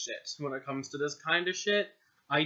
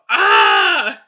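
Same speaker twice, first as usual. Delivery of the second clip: much louder hammed-up scream of pain; obnoxious scream; 8 semitones higher, 17 dB louder than usual. Reader screaming for the boy in pain. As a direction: let out a fake agony shriek. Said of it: "This fucking obnoxious scream".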